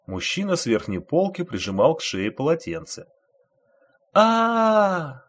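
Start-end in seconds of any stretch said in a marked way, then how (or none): 4.1-5.2 high, loud alarmed shout; short cry. A narrator's reading. Someone in the carriage crying out at the blood. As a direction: yelp at the sight of blood. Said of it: "снова закричал кто-то".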